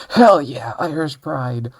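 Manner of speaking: loud, brash jokey cheer for Ireland; a hint of a rowdy Irish fan; mock patriot cheer. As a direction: whoop it up like a proud fan. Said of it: "I'm all like".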